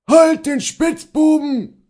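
high, full-volume pursuit yell; possessed shouting. A narrator's reading. Mob with dogs chases the servant, frenzied shout.

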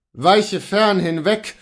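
loud, slow cry of horror; exclamation. A narrator's reading. Luther shouting at the intruder, loud and slow. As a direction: recoil and cry out.

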